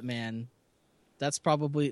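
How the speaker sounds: normal talking voice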